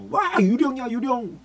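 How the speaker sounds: high, startled ghost cry; frightened cry. Acted. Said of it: "소리를 질렀습니다". (물 위의 예수를 보고 겁에 질려 높게 외치는 제자들)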